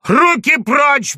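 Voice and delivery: furious shout. Acted. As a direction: drag each word out in rage at the gendarmes. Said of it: "бешено кричал Пушкин".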